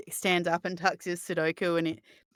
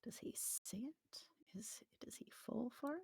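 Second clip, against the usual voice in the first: hushed, breathless question; whispering; 5 semitones higher, 21 dB quieter than usual. Real, not acted. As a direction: whisper it so others can't hear. GM whispers secret roll question.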